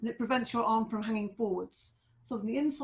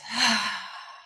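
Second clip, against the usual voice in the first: long sigh of released tension; exhaling sigh. Shown as a demonstration. Teacher letting go after one of those weeks, long sigh. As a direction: breathe out audibly through the mouth. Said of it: "then out through the mouth".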